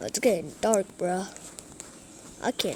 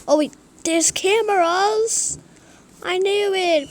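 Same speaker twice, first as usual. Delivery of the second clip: high, loud excited discovery; excited shout; 10 semitones higher, 8 dB louder than usual. Real, not acted. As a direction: blurt it out, thrilled. Spots cameras, exclaims.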